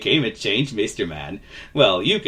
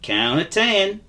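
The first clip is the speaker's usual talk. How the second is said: higher, drawling folksy voice; friendly vagabond; 9 semitones higher, slower than usual. Acted. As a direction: confide kindly in a nervous little chap. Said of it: "he said to Mister Nervous".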